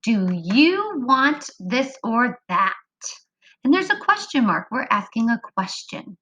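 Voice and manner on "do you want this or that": slow, even, word-by-word reading; slow echo reading. Shown as a demonstration. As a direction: read each word slowly so they can echo. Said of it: "Let's echo read it together".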